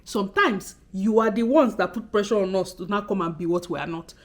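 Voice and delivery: ordinary talk, nothing marked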